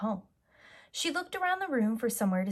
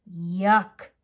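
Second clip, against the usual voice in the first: disgusted yuck; 8 dB louder than usual. Acted. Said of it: "she said".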